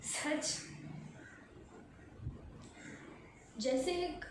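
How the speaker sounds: quietly, haltingly mourn a broken bond; subdued sorrow. Acted. Leaving a home of many years, subdued and sorrowful.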